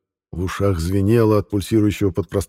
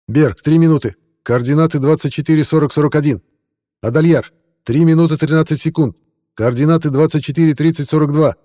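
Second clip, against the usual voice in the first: loud, higher coordinate calls; loud radio callout; 7 semitones higher, 6 dB louder than usual. Acted. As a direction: bark out the numbers. Character calling coordinates over comms.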